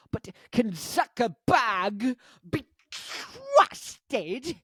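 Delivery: high, slow, drawn-out mock question; mock dramatic voice. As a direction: ham up the big question.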